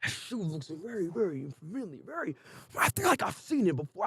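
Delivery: mock detective musing to himself. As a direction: murmur it like a sleuth studying a clue. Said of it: "this guy actually, like, was like".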